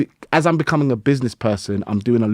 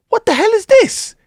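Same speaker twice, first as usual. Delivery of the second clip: much higher, startled amazement; amazed disbelief; 4 dB louder than usual. Retold or imitated. Recalling staring at the wall after training, much higher in amazed disbelief. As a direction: squeak it out in wide-eyed wonder.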